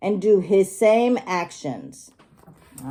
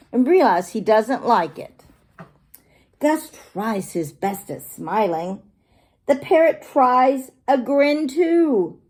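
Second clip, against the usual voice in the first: plain reading voice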